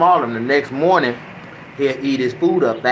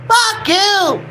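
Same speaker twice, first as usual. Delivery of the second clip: shrill high parrot squawk, way up in pitch; squawking parrot swearing at its owner; 3 dB louder than usual. Retold or imitated. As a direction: screech it like a cursing parrot. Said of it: "That motherfucker told me right then".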